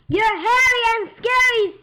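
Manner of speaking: high, loud robot taunt; robot pig voice. Acted. Robot pig taunting the wolf, loud and high. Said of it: "said the robot pig".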